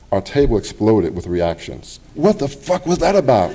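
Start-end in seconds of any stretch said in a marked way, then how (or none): 2.1-3.5 stunned outburst. Retold or imitated. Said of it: "one person said".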